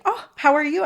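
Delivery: high, eager "how are you"; bubbly and warm. Retold or imitated. Chatting with the girl in the dream, bubbly greeting. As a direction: brighten up as you ask.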